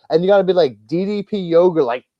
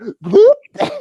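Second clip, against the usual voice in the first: high, loud squealing laugh as he drops down; yelping laugh; 5 dB louder than usual. Retold or imitated. Escaping the security guard's grip, high squealing yelp-laugh. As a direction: let out a sudden high yelp-laugh while ducking.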